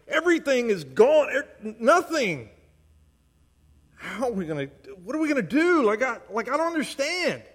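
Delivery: high-pitched, mounting distress, building to bewildered pleading; Habakkuk's lament. Acted. Preacher acting out the prophet questioning God, increasingly exasperated. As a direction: cry out as a prophet at a loss. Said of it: "He says".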